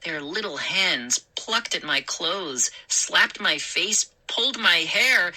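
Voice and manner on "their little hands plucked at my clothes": usual speaking voice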